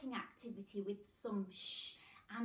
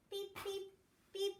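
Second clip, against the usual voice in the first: machine beeps; 8 semitones higher, 3 dB quieter than usual. Pretend.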